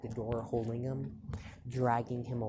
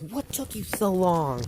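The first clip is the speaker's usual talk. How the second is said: higher, louder impatient outburst; angry demand; 8 semitones higher, 8 dB louder than usual. Acted. Mom confronting Deku on his return, angry demand. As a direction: demand it like an irate parent.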